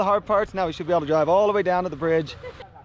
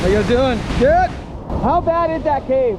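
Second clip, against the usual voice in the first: raised, loud call over the river; 5 semitones higher, 5 dB louder than usual. Real, not acted. Checking on paddlers near the cave, calling out. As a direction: holler across to the group.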